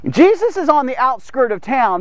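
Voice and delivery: normal talking voice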